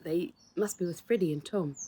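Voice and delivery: ordinary delivery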